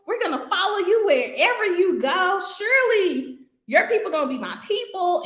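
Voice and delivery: nice and kind. Retold or imitated. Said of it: "we're trying to be nice and kind".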